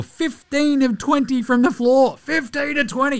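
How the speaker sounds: high, drawn-out marvelling at the stat; amazed. Real, not acted. Podcaster to listeners, marvelling at the shooting line, high and drawn out.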